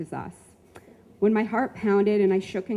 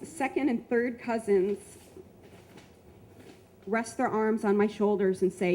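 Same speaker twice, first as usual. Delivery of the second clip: ordinary delivery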